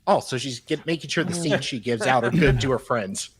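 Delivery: usual speaking voice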